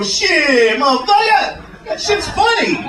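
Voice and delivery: hammed-up high-pitched caricature.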